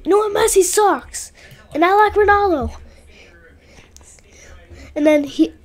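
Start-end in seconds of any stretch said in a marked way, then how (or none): 0.1-2.8 mock classmate. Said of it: "And he's like".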